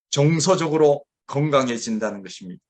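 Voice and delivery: ordinary talk, nothing marked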